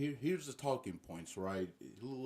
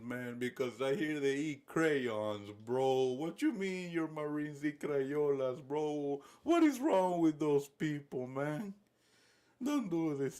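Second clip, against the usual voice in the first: high, drawn-out pleading; hammed-up, whiny begging for mercy; Nicolás Maduro; 4 semitones higher, 3 dB louder, slower than usual. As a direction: beg slowly and desperately in a put-on voice. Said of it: "that's Nicolas Maduro right now. Right, just begging Donald".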